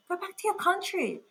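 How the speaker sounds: imitating a passer-by's jeer; retold heckler. Stranger in the street to her, mock heckling.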